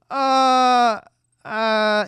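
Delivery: high, loud hesitant uh; hammed-up stalling; mock speechless stammer. [name] lost for words at the dinner invitation, high mock stammer.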